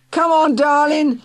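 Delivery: high, loud coaxing yelp; yelping reporter. Acted. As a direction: yelp it like a pushy hack wheedling. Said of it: "he yelped".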